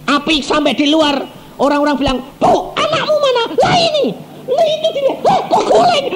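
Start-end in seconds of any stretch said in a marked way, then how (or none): 2.4-3.4 high, urgent call to the mother, lightly acted; a hint of panicked neighbour asking; mock alarmed neighbour. Neighbours shout at the fleeing mother, high and urgent. 3.5-6.1 high, flustered mock panic; panicked mother. Mother discovers she carried the bolster, high flustered panic.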